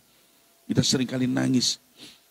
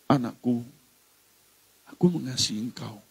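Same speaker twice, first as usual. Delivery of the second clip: tender; 2 semitones lower, 5 dB quieter than usual. Acted. God speaking love to a child, tenderly. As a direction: say it gently, with room between words.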